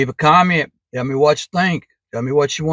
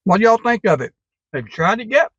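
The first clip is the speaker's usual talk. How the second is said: talking with a mouth full; 7 semitones higher, 3 dB louder than usual. Real, not acted. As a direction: speak through a mouthful of food. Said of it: "Sorry for talking with my mouth full".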